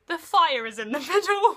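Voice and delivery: loud, high, laughing through it; laughing indignation. Retold or imitated. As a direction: protest while cracking up. Retold argument, laughing.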